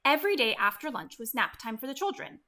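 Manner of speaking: ordinary delivery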